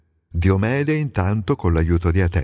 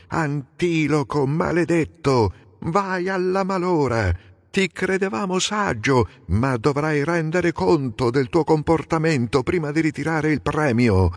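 furious yell; 8 semitones higher than usual. A narrator's reading. Menelao cursing Antiloco for reckless driving, high angry yell. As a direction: curse him out, pitched way up. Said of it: "urlò ad Antiloco".

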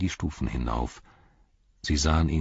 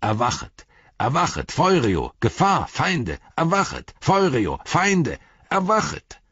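high, ringing horn-call cry, climbing higher; rousing alarm call; 7 semitones higher than usual. A narrator's reading. Horn call rousing the land against enemies, urgent rising alarm cry. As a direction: cry each word like a horn blast. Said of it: "nun ließ der Hornruf von Bockland die Luft erzittern".

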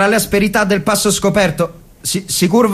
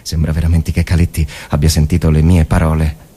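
inner monologue; faster than usual. Acted. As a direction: think aloud in the dark trench.

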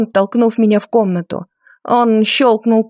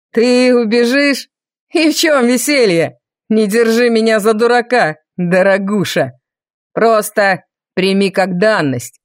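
menacing villain. A narrator's reading. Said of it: "заявил Виконт".

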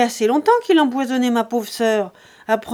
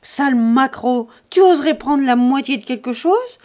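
furious insults; 3 semitones higher, 7 dB louder than usual. A narrator's reading. Lise insulting Jean over the inheritance, furious shout. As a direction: spit the insults at him.

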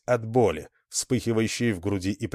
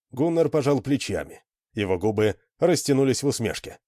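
plain reading voice

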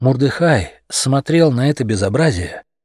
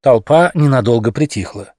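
plain reading voice

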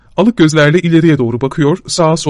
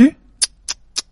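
tongue clicks; 10 semitones higher than usual. Acted.

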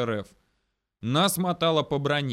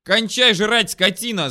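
loud, high angry order; yelled in chorus; 8 semitones higher, 10 dB louder, slower than usual. A narrator's reading. Soldiers to their chewing comrade, angry yelling. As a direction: bawl at him to stop. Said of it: "заорали мы ему хором".

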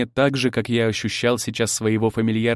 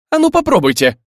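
loud, high angry challenge; fiery dare; 11 semitones higher, 8 dB louder than usual. A narrator's reading. Youngest bride daring both rivals to fight, fiery shout. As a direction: dare them in a raised, heated voice. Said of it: "глаза горели".

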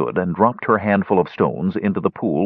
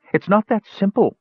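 high, sharp protest; crying out; 13 semitones higher than usual. A narrator's reading. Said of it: "Parian cried".

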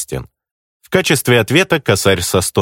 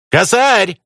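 much higher, calling out; taunting call; 12 semitones higher than usual. Acted. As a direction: shout the name across. Enemy calls out mockingly. (насмешливый окрик)

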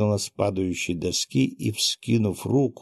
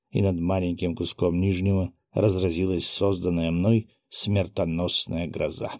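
ordinary delivery

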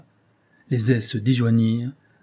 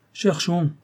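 a higher, sharp call to his men; rally the men to search; shouted order; 6 semitones higher than usual. Acted. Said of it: "cria Osman aux siens". (ordre crié à ses hommes, voix haute)

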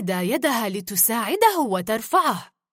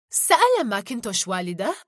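usual speaking voice

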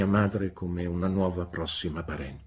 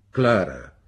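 sharp, loud reproach of a name; stern exclamation; 7 dB louder than usual. A narrator's reading. Spinster scolding the young wife, sharp and loud. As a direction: bark the name as a rebuke. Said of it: "esclamò con severità".

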